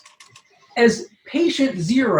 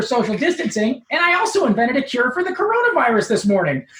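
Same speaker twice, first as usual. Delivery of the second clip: much higher, nerdy showoff voice; Steve Urkel voice; 7 semitones higher than usual. Acted. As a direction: brag like a pompous nerd in love. Steve Urkel's lines in the story.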